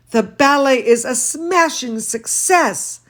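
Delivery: lively read-aloud voice; storybook reading for children; story reading. A narrator's reading. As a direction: read to the kids with expression. Reads picture book aloud.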